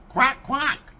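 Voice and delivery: high, far quack quack; duck quack impression. Shown as a demonstration. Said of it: "Sounds like it should be".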